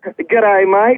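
attempted Australian accent greeting; imitated Aussie accent. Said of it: "pretty good Aussie accent".

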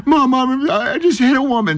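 put-on high, tearful child calling for mom; crying little boy. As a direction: play the panicked five-year-old in tears. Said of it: "I'm in tears".

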